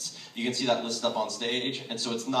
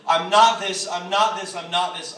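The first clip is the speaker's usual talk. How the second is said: louder, higher emphatic repetition, easing slightly each time; emphatic repeated line; 6 semitones higher, 8 dB louder, slower than usual. Retold or imitated. Speaker re-enacting last night's speech, emphatic repetition. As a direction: re-enact a forceful stage line. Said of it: "the point where I said it".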